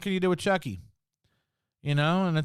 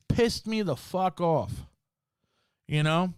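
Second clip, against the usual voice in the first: ordinary talk, nothing marked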